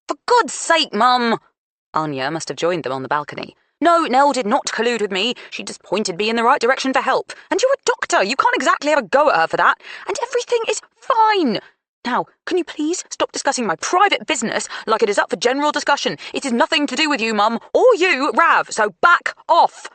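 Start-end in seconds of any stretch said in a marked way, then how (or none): 0.1-1.4 high, loud exasperated outburst; exasperated shout. A narrator's reading. Daughter explodes at mother. 3.8-19.9 loud, high, rapid angry rant; angry outburst. A narrator's reading. Anya furious at family.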